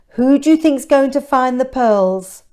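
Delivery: ordinary delivery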